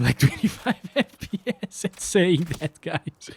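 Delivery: giggling mid-sentence; laughing. Real, not acted. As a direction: laugh while saying it. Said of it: "I can't keep a straight face".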